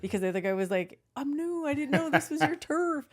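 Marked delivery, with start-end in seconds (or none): 1.2-3.1 high-pitched pleading excuse, imitated; mock defensive newcomer. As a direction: play the rival backing down, voice raised high. Other driver excuses himself in story.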